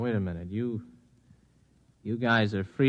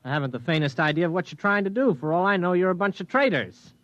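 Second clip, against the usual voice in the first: rising, higher and louder, words tumbling fast; indignant outburst; 6 semitones higher, 4 dB louder than usual. Acted. Pilot snapping back at the rebel leader, indignant and rising. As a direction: push back hard, suspicious and fed up.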